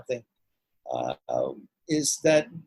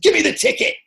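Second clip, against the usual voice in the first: put-on jokey British accent pitched way up and loud; hammed-up British accent, louder and much higher; bad British accent; 9 dB louder than usual. A kid at a Bath ticket booth in a bad British accent. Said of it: "in my worst British accent".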